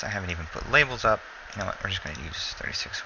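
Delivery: usual speaking voice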